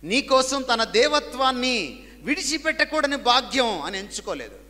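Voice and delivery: loud, high, slow building sermon climax; full-force preaching. Acted. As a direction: preach it out with rising fervour.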